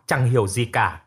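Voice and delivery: ordinary delivery, nothing marked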